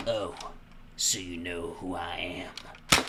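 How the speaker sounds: low, slow Southern drawl; Southern-accented cop. Acted. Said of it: "he's gonna get a southern accent".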